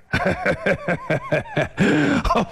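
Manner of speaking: big guffaw from the strongman; hearty laugh. Acted. Yu Heng laughs at order.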